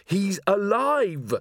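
high, drawn-out cry; slow, soaring exclamation; amazed insistence. Acted. Carrot insists Dorfel is alive.

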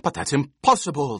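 protesting. A narrator's reading. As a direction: insist it can't be. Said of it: "the Baron protested".

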